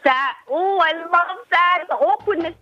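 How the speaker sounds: high, drawn-out relish; gushing delight. Real, not acted. Guest telling host they love the awkwardness, high gushing delight. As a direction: savour it with real, gushing delight. Gushing over the cringe she loves.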